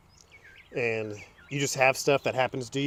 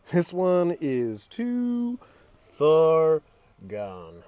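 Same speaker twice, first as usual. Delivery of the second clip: very slow, one word at a time; drawn-out verdict; much slower than usual. Real, not acted. Emphatic verdict on the ruined dash.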